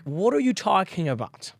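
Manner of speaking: puzzled, put-on incomprehension; mock total bafflement at a mispronunciation; baffled. Natives hearing a mispronounced word, mock bafflement.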